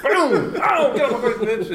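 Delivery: high, laughing mock battle cry; excited mock shout. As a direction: yell it out laughing like the fight scene.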